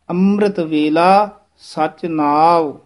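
slow, careful recitation; teacher models the line slowly; slow model reading. Shown as a demonstration. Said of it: "ਪੋਲਾ ਬੋਲਣਾ ਹੈ".

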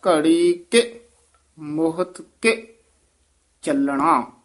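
ਘੜੀ ਕੇ, pause, ਮੋਹਤ ਕੇ, pause, ਚੱਲਣਾ falling; two pauses. Shown as a demonstration. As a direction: mark a stop after ਘੜੀ ਕੇ and after ਮੋਹਤ ਕੇ. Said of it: "ਦੋ ਠਮਕਾਂ ਲਾ ਕੇ".

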